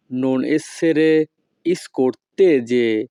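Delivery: each Italian word spaced out very slowly; slow teaching model. Shown as a demonstration. Teacher models Italian phrase for students, very slow. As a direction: spell it out slowly for learners to copy. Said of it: "তখন আমাদেরকে বলতে হবে".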